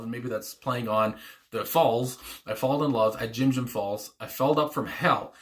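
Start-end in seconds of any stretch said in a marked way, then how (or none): none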